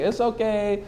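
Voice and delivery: high, soft pitying coo; consoling women. Acted. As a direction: imitate women gently consoling a flopped comic. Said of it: "you want a chick to say".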